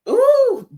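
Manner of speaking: loud, high-pitched "ooh" of irritation; exasperated "ooh". Real, not acted. Reviewer whose blood is boiling, exasperated ooh. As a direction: let out a sharp high ooh. Riled up, before "boils my blood.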